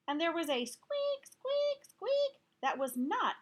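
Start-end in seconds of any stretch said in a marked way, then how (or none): 0.6-2.4 squeaky. A narrator's reading. Squeak sounds in story.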